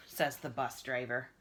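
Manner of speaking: ordinary delivery, nothing marked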